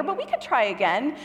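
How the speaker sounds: ordinary talk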